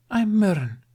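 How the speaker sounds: high, kindly mermaid hello; kind introduction. A narrator's reading. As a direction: introduce herself gently, friendly. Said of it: "introduced kindly".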